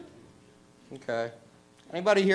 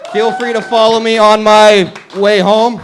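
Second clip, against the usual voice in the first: slow loud creepy invite; 5 semitones higher, 11 dB louder, slower than usual. Acted. Creepy closing joke, slow and loud.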